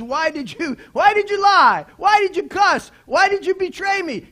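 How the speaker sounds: high, loud, accusing questions; accusing shout. Pretend. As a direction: hurl the accusations at him. Imagined Jesus confronting Peter.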